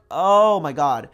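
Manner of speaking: slow, loud oh my God as it dawns; realization gasp. Retold or imitated. Vlogger reliving the moment she understood, loud and drawn out. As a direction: let the penny drop out loud and slow. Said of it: "it clicked for me and I was like".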